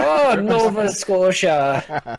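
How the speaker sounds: put-on slow, drawn-out try at a Nova Scotian accent; attempted Nova Scotian accent. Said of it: "That's not a Nova Scotian accent".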